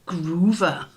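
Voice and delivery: real amused laugh on the word. Real, not acted. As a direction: say it as the giggle breaks through. Silly word makes her laugh.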